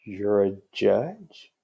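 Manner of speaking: very slow drawn-out disbelief; doubtful question. Retold or imitated. Bailiff doubting the badge, very slow incredulous. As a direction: stretch it, can't believe it. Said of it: "he says".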